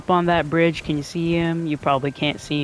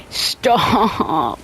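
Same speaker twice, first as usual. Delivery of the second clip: laughing; 4 semitones higher, 4 dB louder than usual. Real, not acted. Laughing at own joke.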